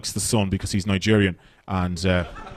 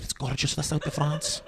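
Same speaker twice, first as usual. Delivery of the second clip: soft, airy, higher gush; dreamy tourist; 4 semitones higher, 11 dB quieter than usual. Retold or imitated. Audience member gushing about the south of France, soft and dreamy. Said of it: "You're like".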